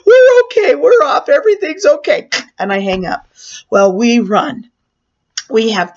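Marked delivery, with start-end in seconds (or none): 0.1-2.3 much higher, louder breathless relief; relieved. Retold or imitated. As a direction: blurt it to the operator, overjoyed. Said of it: "I tell the nine one operator".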